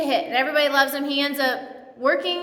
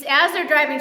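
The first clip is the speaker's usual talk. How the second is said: normal talking voice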